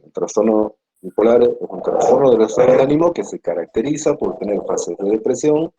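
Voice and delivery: ordinary talk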